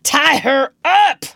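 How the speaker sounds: loud, slow pirate shout; captain finding his voice and shouting, slow and loud. A narrator's reading. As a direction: bark the order across the deck. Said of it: "he shouted".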